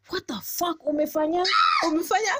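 high-pitched exasperated questions, building to a cry; imitating frustration. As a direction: act out a frustrated, disbelieving guy. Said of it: "this guy is so frustrated".

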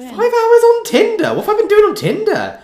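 high, loud and incredulous; amused disbelief. Real, not acted. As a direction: exclaim it high, laughing in disbelief. Exclaims at surprising screen-time figure.

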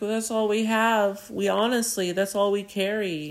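high, meek protest; high-pitched, helpless excuse; pleading old lady. Retold or imitated.

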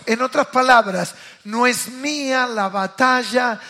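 usual speaking voice

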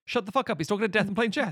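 a touch of mock outrage at the film; mock exasperated rant. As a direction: scoff at the silly plot as if fed up. Mock rant about film logic.

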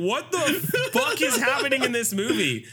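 high, incredulous mock outcry; baffled outburst. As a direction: squeal out your disbelief at the movie. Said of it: "you're like".

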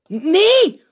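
high, loud exasperated no; blurted no. A narrator's reading. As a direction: blow it out in frustration. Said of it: "blaast de opperpiet".

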